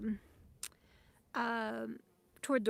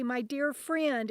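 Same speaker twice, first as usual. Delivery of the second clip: usual speaking voice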